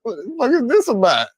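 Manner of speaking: loud, high, exasperated street voice; high, loud, annoyed imitation of Bank; Bank's irritated voice. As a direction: push back hard at the yelling, heated. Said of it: "the impression I got from Bank".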